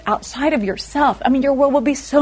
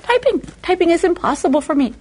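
whiny complaint; 6 semitones higher than usual. Retold or imitated. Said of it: "I said".

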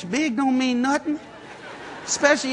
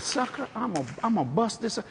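a soft menacing threat; 8 dB quieter, faster than usual. Retold or imitated. Pinning the dealer to the truck, a quiet threat. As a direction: threaten him under your breath.

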